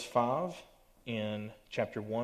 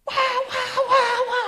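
squeaky, frantic squirrel squeal, much higher and louder; panicked squirrel; 12 dB louder, slower than usual. Pretend. Youth leader acting out a panicked squirrel for teens, shrill laughing squeal. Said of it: "that squirrel goes just".

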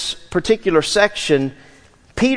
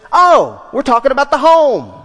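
sudden realization; 6 semitones higher, 4 dB louder than usual. Real, not acted. Sudden insight while studying definition.

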